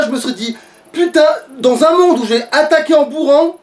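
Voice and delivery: usual speaking voice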